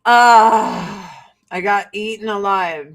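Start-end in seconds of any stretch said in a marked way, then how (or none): none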